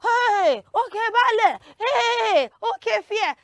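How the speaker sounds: loud, high calls to passing drivers; urgent acted street hailing; hailing a ride. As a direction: shout to flag down a bus. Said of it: "you're like".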